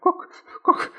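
high, breathy startled cry of a rooster; frightened cry. A narrator's reading. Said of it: "at once cried".

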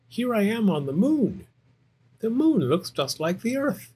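high, wondering bear cub; amazed Little Bear. Acted. Little Bear thinking he's on the moon, high and amazed. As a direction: play a young bear discovering a new world.

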